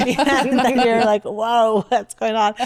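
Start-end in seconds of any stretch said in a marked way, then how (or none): 1.2-2.5 higher, lightly hammed-up surprise; mock alarmed whoa. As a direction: play your own startled self, half joking. Retelling own reaction to trigger shot.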